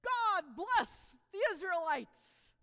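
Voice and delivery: loud, slow proclamation; Balaam defiantly blesses Israel, loud and slow; loud blessing. Acted. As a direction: proclaim it boldly. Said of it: "Balaam gets up there and says".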